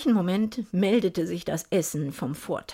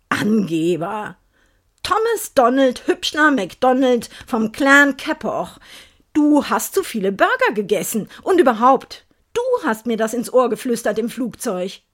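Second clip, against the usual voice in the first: teasing; 3 semitones higher, 6 dB louder than usual. Acted. Teasing him after punching ribs.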